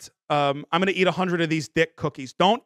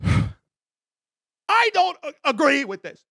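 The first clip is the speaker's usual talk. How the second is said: frustrated protest; 8 semitones higher, much slower than usual. Real, not acted.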